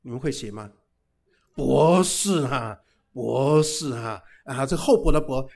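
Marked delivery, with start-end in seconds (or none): 1.5-4.2 博士 said very slowly and loudly; 博士 slow and loud, both syllables drawn out; drawn-out emphasis. Shown as a demonstration. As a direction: stretch out 博士 word by word. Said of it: "我这个博士是厚薄的薄".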